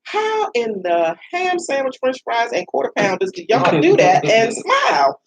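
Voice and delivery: high, rising to a shout of disbelief; exasperated comic rant. Retold or imitated. Exasperated speaker ranting about people who smile while pimped, high and shouting. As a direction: pile up the silly oaths until you yell.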